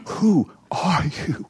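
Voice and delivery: high, breathy whispered wonder; a whispered prayer of awe to God; awed whisper. Real, not acted. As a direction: ask it in a hushed, awestruck whisper.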